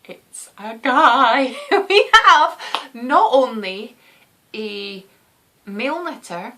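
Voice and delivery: bursting with excitement at the news; excited. Real, not acted. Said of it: "so excited about this".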